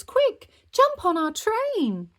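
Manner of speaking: high, loud, slow call; yelled. Acted. Kids calling parents aboard the train, yelled. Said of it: "yelled the drivers".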